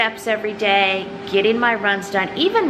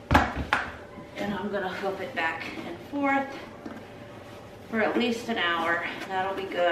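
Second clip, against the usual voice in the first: ordinary talk, nothing marked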